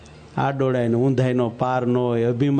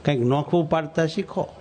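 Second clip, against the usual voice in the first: ordinary talk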